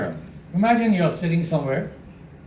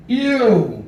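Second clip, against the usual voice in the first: loud, high, slow grossed-out ew; hammed-up disgust, louder and higher; mock disgust; 8 semitones higher, 7 dB louder, much slower than usual. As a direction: pretend to be revolted by what's in the mouth. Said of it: "and I said".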